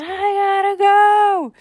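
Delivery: put-on high, stretched-out kid wail; hammed-up urgent shriek; desperate yell. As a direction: wail it loud in toilet panic. Candy desperate for the toilet.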